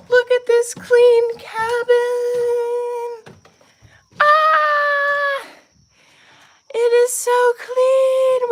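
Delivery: high, slow, drawn-out delight; proud showing off. Real, not acted. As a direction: savor every word of pride. Showing off clean cabin.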